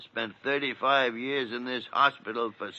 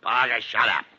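irritated command; 6 dB louder than usual. Acted. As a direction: silence the nurse impatiently. Doctor snaps at interrupting nurse.

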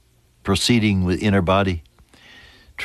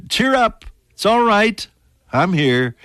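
fervent comfort; 11 semitones higher than usual. Acted. Preacher voicing Jesus to the congregation, fervent and ringing. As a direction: proclaim it like a joyful call across the room.

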